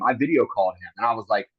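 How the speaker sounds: usual speaking voice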